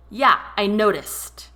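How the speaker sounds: loud, slow, dry sarcasm; sarcastic interruption. A narrator's reading. Laurel interrupts David's apology, loud and sarcastic. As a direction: cut him off with scorn. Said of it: "Laurel interrupted".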